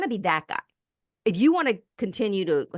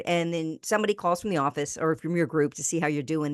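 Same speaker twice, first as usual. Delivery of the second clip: ordinary talk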